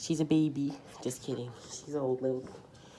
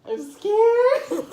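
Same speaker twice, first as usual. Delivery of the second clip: laughing scared; 12 semitones higher, 5 dB louder than usual. Real, not acted. Blindfold taste test, shrieking laugh.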